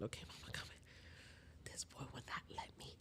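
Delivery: breathy whisper so he sleeps. Real, not acted. Mother whispering while settling son, real whisper.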